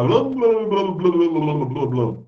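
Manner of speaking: high, loud burst of babble tumbling downward; rapid nonsense blabber, sliding down in pitch; mock babbling. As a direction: imitate gibberish tongues, starting high and loud, then dropping. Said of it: "se ele ficasse lá".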